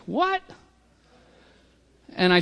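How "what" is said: incredulous what. Retold or imitated. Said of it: "And I looked at him like".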